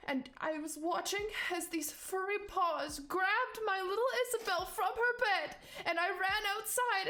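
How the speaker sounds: high, distraught mother's testimony; acted near-crying, pitch climbing high; hysterical, tearful. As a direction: build from shaken recall to frantic accusation. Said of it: "like, crying".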